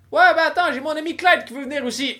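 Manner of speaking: high-pitched buddy voice; high goofy voice. Acted. Voicing Greg in story.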